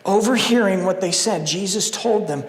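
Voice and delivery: ordinary delivery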